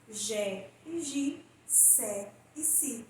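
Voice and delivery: Gé e Gi, Sé e Si spelled out slowly and softly; slow sound demo. Shown as a demonstration. Teacher slowly sounding out syllables for students. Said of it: "vão produzir sons diferentes".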